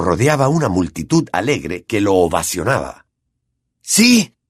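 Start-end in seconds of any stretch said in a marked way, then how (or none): 3.8-4.4 high loud cheer; a triumphant shout of joy; shouting yes. A narrator's reading. Ron lifts Harry's arm, loud cheer. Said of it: "gritó Ron".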